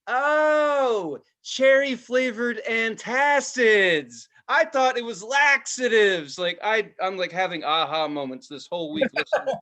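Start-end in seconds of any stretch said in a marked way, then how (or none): 0.1-6.4 high, loud, slow dawning surprise; aha realization. Retold or imitated. Adult realizing lyric meaning, high slow surprise. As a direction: draw it out as the penny drops.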